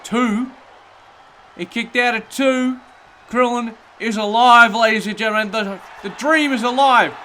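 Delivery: high, loud ecstatic play-by-play; wrestling commentator. Acted. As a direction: erupt as he kicks out. Krillin kicks out, excited call.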